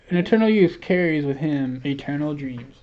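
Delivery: normal talking voice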